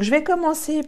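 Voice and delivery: ordinary talk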